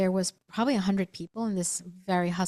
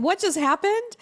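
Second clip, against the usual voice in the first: panicked surprise; 11 semitones higher, 4 dB louder than usual. Retold or imitated. Voicing panicked lunch companions.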